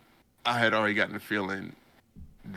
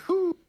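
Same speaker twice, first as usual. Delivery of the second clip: sharp high impressed whistle; loud high whistle of awe; 8 dB louder than usual. Retold or imitated. Seeing the dead bears, a loud high whew. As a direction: let out a loud amazed whew. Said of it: "I was like".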